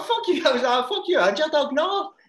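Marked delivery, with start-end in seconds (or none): 0.0-0.4 high-pitched indignant retort; retold outburst. Telling friend about refusing to face the dog, high indignant outburst. 0.9-2.1 loud, high mock-indignant refusal; retold outburst. Telling friend about cursing someone and their dog, high indignant outburst.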